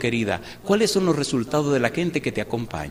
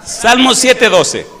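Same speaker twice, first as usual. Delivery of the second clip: higher and much louder, thundering from the pulpit; fiery preaching; 10 semitones higher, 12 dB louder, much slower than usual. Acted. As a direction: blast it out at the congregation, half mocking. (predicación a todo pulmón)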